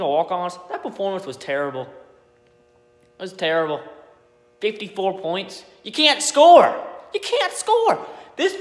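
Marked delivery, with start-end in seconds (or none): 5.8-8.6 high, loud, slow yell; exasperated yell. Real, not acted. Fan ranting at his team's scoring, exasperated yell. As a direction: shout it in disbelief at the team. Furious rant about the team.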